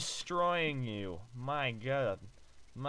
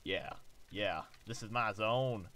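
mock cocky player; 4 semitones lower, 4 dB quieter than usual. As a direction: voice the player's smug look. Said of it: "You're like".

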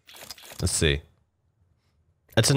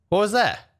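startled; 12 semitones higher than usual. Real, not acted. Reacting to strange game voice.

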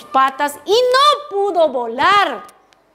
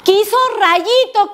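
ordinary delivery, nothing marked

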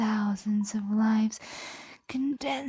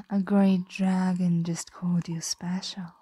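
ordinary talk, nothing marked

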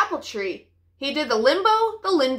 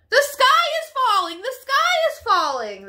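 shouting; 11 semitones higher than usual. Acted. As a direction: shout back at the fox. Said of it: "they shouted".

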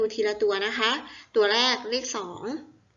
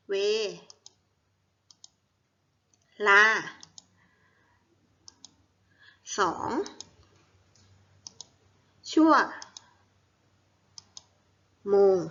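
each syllable alone, very long pauses between; syllable-by-syllable dictation. Shown as a demonstration. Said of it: "อ่านว่า".

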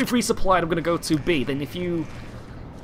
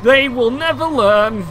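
slow, loud, drawn-out gloat; stretched-out mock-triumphant declaration; 3 semitones higher, 6 dB louder, slower than usual. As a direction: proclaim it grandly over beaten foes. Gloats over enemies hitting mines.

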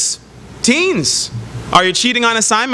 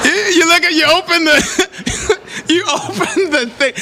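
high laughing voice, slowing down; opening the pricey menu, laughing, much higher; 11 semitones higher, slower than usual. Real, not acted. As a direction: laugh through the words at the bill.

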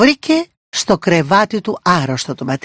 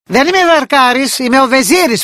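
higher, louder gloating cry; triumphant shout; 7 semitones higher, 4 dB louder than usual. Acted. Vizier reveals himself to the princess, gloating shout. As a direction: throw off the disguise with a shout. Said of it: "φώναξε εκείνος".

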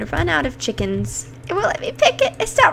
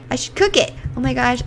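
ordinary talk

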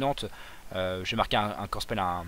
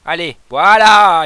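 higher and much louder, slow and drawn out; excited cheer; 6 semitones higher, 18 dB louder, much slower than usual. Real, not acted. Gamer cheering on his team to viewers, loud and slow. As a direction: celebrate the coming error.

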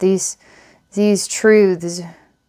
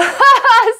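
high giggle; 4 dB louder than usual. Real, not acted. Amused at her own vision.

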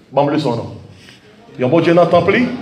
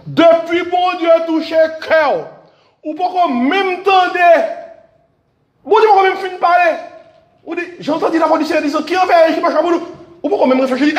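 full-force preaching; 12 semitones higher than usual. Acted. Pastor to his church, preaching at full force. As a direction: lift the congregation with it.

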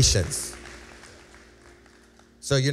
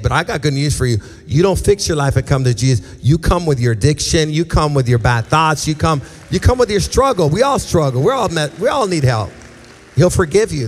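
full-force preaching; 2 semitones higher, 6 dB louder than usual. Acted. Altar call build.

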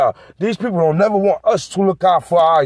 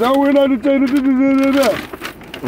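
higher, dismissive cop impression; mocking the officers as they deny detaining him; 7 semitones higher than usual. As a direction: brush him off like a bored officer. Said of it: "And they was".

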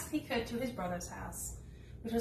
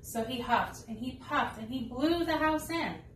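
usual speaking voice

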